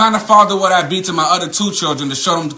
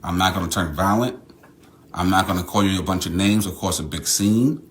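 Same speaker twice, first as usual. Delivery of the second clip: quiet at night; 6 semitones lower, 7 dB quieter than usual. Real, not acted. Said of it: "Because the kids are asleep".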